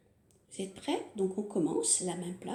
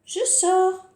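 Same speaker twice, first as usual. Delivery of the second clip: higher, louder cheerful cry; cheerful pop-out; 9 semitones higher, 11 dB louder than usual. Pretend. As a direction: burst out happily. Thumb pops out.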